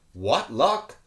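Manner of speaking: ordinary delivery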